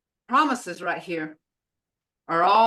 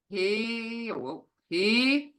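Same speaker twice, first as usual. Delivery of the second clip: ordinary talk, nothing marked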